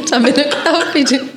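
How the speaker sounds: laughing through the words; amused laugh while talking. Real, not acted. Friend laughs at the mixup, laughing.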